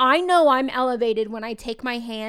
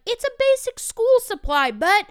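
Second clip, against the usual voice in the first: mock exasperation, pitched high and louder; frustrated; 10 semitones higher, 5 dB louder than usual. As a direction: imitate a fed-up teacher, voice jumping up. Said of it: "we'd be frustrated".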